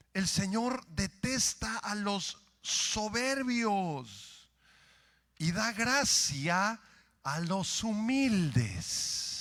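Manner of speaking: drawn-out, weighty scripture line; slow reverent reading of scripture; slow solemn pronouncement. Acted. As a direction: let each word land slowly and gravely.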